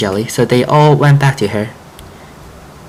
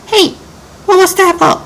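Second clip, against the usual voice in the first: put-on much higher kid voice, upset; little brother yelling. Youngest child yelling at frog over dominoes, high kid voice. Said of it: "Birdie yelled".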